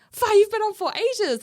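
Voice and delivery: high, loud mock protest; retold sibling complaint. Siblings complain about their computer turn, high mock protest.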